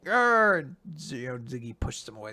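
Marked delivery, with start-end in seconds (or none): 0.1-0.6 loud growling battle cry. Retold or imitated. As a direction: charge with a roar. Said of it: "who's just like".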